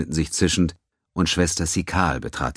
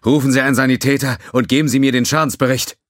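urgent orders; 9 semitones higher, 7 dB louder, faster than usual. Acted. Officer barks orders in crisis.

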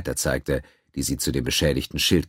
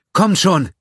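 urgent shout; 14 semitones higher, 8 dB louder than usual. Acted. Doctor urges her staff.

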